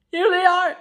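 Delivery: bursting with joy at the reveal; excited reveal. Real, not acted. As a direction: announce it with delight.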